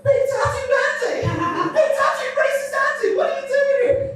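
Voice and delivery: very high, squealed mock outrage; high-pitched outcry. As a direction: cry out in a comically high voice. Mock outrage at a racist remark.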